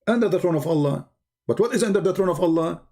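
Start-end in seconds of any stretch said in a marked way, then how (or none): none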